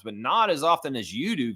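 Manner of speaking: normal talking voice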